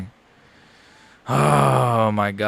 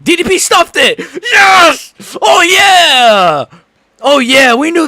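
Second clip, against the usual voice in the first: much higher, much louder triumphant yell; ecstatic shout; 14 semitones higher, 8 dB louder, slower than usual. Real, not acted. Streamer celebrating his fighter stuffing the takedown, ecstatic shout. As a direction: scream it like your fighter just won the moment.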